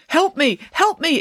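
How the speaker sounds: high-pitched plea for help; urgent, high cry for help. A narrator's reading. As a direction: call out for rescue in a high voice. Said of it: "it cried".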